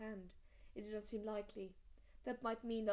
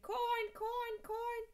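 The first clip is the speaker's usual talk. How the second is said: high, loud squawking caw; raven screech; 13 semitones higher, 5 dB louder, much slower than usual. A narrator's reading. Raven screaming at John in the cellar. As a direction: screech it like a crow. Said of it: "Mormont's raven screamed at him".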